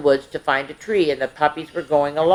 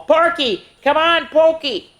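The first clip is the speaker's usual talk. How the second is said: high calling voice from a distance; sister calling; 11 semitones higher than usual. A narrator's reading. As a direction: call your brother to come along. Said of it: "his sister Pickles call".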